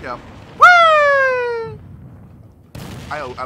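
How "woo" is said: high loud whoop; imitated victory woo. Gamer showing his win cheer, high loud whoop. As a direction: whoop like you just won. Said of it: "And I'm saying, like".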